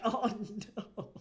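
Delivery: mock dismay through laughter; laughing dismay. Streamer reacts to Greek players' reviews, laughing dismay. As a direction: laugh out a drawn oh no.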